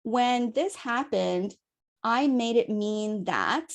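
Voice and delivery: slow, measured sentence starter; slow demo phrasing. Shown as a demonstration. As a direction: lay it out slowly like a model script. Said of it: "you can begin by saying".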